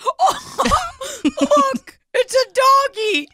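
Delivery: put-on very high, childish delight; excited childlike voice. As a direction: squeal like a kid seeing a puppy.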